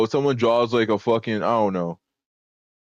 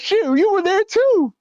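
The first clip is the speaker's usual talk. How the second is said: high, slow teasing retort; mock comeback; 10 semitones higher, slower than usual. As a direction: drag it out like a gleeful gotcha. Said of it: "He's like".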